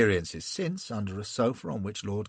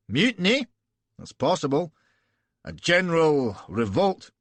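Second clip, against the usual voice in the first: higher, louder Scottish burr; 5 semitones higher, 5 dB louder than usual. Acted. Broadfoot warning the prince, arrogant Scottish burr. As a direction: arrogant Scot warning of mutiny. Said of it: "arrogant Scotch burr".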